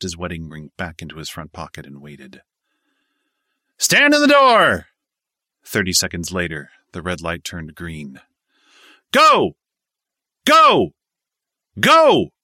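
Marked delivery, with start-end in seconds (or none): 3.8-4.9 slow, long bellowed command; drawn-out shout. A narrator's reading. Jump master barks orders. 9.1-12.3 repeated loud shouts of go; shouting. A narrator's reading. Jump master sends men out.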